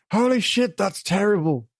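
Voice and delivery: high-pitched comic heckle; hammed-up dismay at a bad accent; mock outburst. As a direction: cut in with a high put-on voice. Mock outburst at his own accent.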